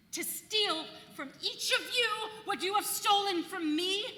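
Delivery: rising fierce declamation; vengeful incantation. Acted. Wife alone vows to take men's power, fierce and rising. Vengeful soliloquy to her broom.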